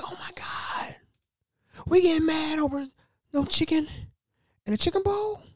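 high squeaky incredulous voice; mock disbelief. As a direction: act amazed in a high put-on squeak. Narrator incredulous at the fight.

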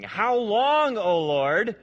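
loud, high anguished appeal to God; Habakkuk crying out. Acted. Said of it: "this is Habakkuk speaking".

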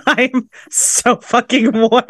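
talking through laughter; giggling while she speaks; laughing. Real, not acted. Laughing about being hot.

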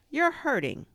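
high, loud complaint; pained cry. A narrator's reading. Pooh protesting as Rabbit pulls him, pained cry. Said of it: "cried Pooh".

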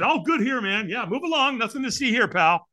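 high, hurried brush-off act; hammed-up insistence that all is well; fake okay. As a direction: wave someone away in a high, over-cheery voice. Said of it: "We lie".

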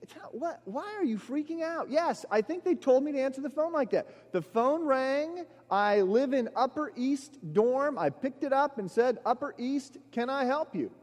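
high, bewildered, spelling it out; exasperated explanation. Retold or imitated. Telling the panicking roommate why it's normal, exasperated. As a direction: explain the obvious, baffled.

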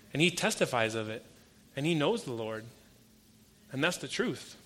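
ordinary talk, nothing marked

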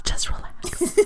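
whispering salon greeter; hushed invitation to sit down; whispered asmr role play. Shown as a demonstration. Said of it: "it'll go something like this".

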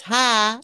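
"Hi" with a flat, drawn-out Tennessee I, like "hah"; flat Southern I. Shown as a demonstration. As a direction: raise the pitch and flatten the vowel in "Hi" to "hah". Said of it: "Tennessee's all about the flat eye".

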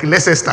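LEICESTER in three syllables, "leh-sess-tah"; mispronounced as spelt. Shown as a demonstration. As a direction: sound out every syllable of "Leicester" as written. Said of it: "that's another funny word".

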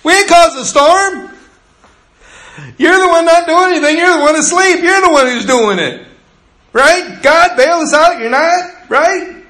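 whiny, defensive fishermen talking back; indignant disciples. Retold or imitated. Disciples answering Jesus back, high and loud indignant. Disciples retorting, voiced by preacher.

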